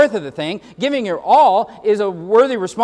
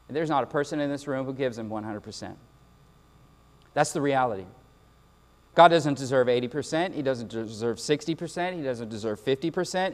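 sober soft admission; 7 semitones lower, 6 dB quieter than usual. Real, not acted. Sober confession to congregation.

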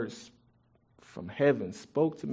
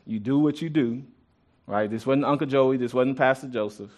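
normal talking voice

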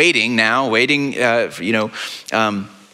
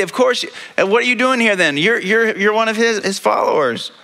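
accuser; 8 semitones higher than usual. Acted. Bystander insists Peter is follower.

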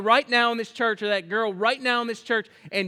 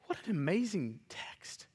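whispered awe; 2 semitones lower, 10 dB quieter than usual. Acted. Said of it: "whispered awe".

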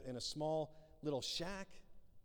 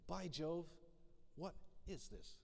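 a touch of a prim horrified Queen, soft; mock royal shock; 10 dB quieter, slower than usual. Preacher voicing the Queen served leftovers, mildly horrified. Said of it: "she's horrified".

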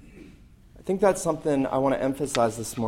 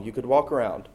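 usual speaking voice

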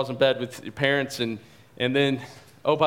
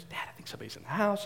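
softer, fast frightened hush; whisper-like fear of an intruder; scared hush; 7 semitones higher, 9 dB quieter, much faster than usual. Retold or imitated. Daughter warning dad about an intruder, scared and hushed.

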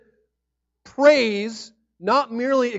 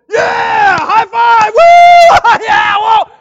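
full-throated cheering, much higher and louder; fan cheering; 14 semitones higher, 18 dB louder, much slower than usual. Pretend. Pastor acting out a football fan's cheer, whooping. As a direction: yell and whoop like a fan at a big play. Fans cheering an amazing play.

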